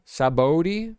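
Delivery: Sabaody as sa-BOH-dee, slow and rising; questioning pronunciation. Shown as a demonstration. Host unsure of the arc's name, testing sa-BOH-dee. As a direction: try Sabaody with a long "oh", as a question. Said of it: "Is that how you say it?".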